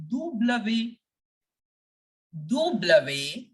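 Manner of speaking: "double" run on as "doo-bluh-vay"; French W. Shown as a demonstration. Teacher modelling French W for learners, deliberate. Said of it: "W will be pronounced as".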